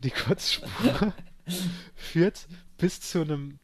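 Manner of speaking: laughing high and slow through the words; can barely get it out for laughing. Real, not acted. Telling his friend about the vomit trail, laughing.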